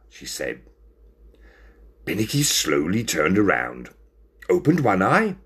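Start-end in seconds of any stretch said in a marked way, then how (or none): none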